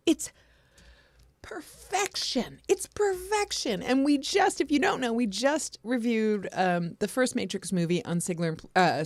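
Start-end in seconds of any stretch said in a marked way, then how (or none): 0.1-3.8 high, drawn-out gush; delighted fan cooing over a costume; gushing admiration. Real, not acted. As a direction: savour "perfection" in a high, slow swoon. Gushing over a fan's costume photo.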